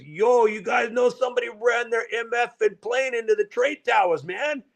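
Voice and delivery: a guy from New York, all attitude; New York accent. Retold or imitated. A New York dock worker breaks the news, in a heavy New York accent. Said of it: "talking like everybody's Joey Buttafuoco".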